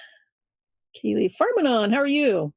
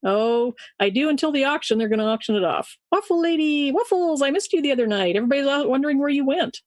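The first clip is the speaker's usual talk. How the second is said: normal talking voice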